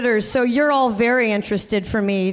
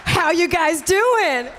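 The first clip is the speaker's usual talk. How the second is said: slow, drawn-out, higher shout to the crowd; mock hype call; 5 semitones higher, slower than usual. Host repeats her greeting to the room, drawn-out hype shout. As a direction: demand an answer like a hype act, stretching the words.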